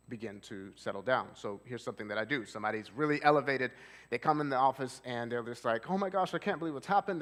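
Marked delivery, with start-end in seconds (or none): 5.9-7.2 high-pitched distressed client, mock crying; a lightly voiced upset client rushing the words. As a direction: act out a client in a panic, pitched up. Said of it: "They're crying".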